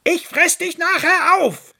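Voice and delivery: very high, very loud threatening shout; shouting after him. Acted. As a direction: yell it after the fleeing rabbit. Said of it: "rief ihm der Fuchs hinterher".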